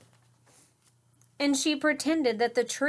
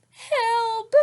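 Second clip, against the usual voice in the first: tearful cry; 13 semitones higher, 5 dB louder than usual. Acted. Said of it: "she sniffed".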